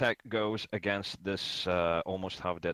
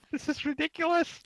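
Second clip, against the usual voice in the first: exasperated; 4 dB louder than usual. Pretend. Exasperated after botched attack.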